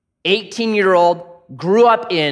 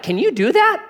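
amazed; 11 semitones higher than usual. Retold or imitated. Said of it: "Matt's like".

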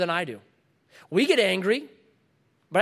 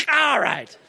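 loud, higher-pitched burst; sudden acted shout; 6 semitones higher, 6 dB louder than usual. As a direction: burst out abruptly as the dentist. Dentist springing the needle after chat.

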